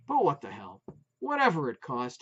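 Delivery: usual speaking voice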